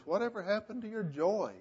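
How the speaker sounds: plaintive question. Retold or imitated. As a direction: ask it like a sad, puzzled rebuke. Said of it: "he says".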